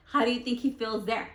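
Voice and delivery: usual speaking voice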